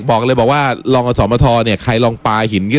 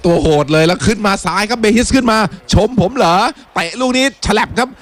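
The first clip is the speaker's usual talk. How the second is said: high-pitched running football commentary; excited match commentator following the ball; live play-by-play; 8 semitones higher than usual. Real, not acted. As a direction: call the attack live, pitch lifted.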